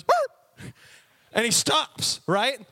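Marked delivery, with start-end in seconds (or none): 0.0-0.7 sudden high-pitched yelp; startled dog yelp. Acted. Dog jerked by the trainer's pinch collar, startled yelp. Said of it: "and Odie goes".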